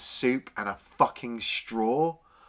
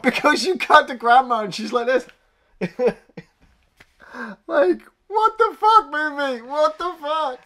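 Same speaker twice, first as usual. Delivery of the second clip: laughing disbelief; 12 semitones higher, 7 dB louder than usual. Real, not acted.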